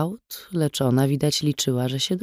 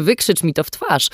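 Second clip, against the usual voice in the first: bitter laugh; 3 semitones higher than usual. A narrator's reading. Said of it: "wrzasnęła".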